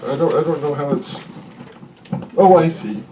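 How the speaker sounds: muffled, struggling with the sweater; sweater over head. Real, not acted. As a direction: talk through fabric caught on the mic. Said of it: "It's stuck on my sweater".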